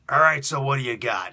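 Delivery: loud, gruff boss opening the meeting; Vince McMahon voice. Retold or imitated. Vince McMahon starting the pitch meeting, loud and gruff. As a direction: bark it like a wrestling tycoon. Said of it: "I'm gonna use my Vince McMahon voice".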